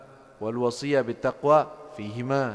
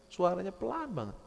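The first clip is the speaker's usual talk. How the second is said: normal talking voice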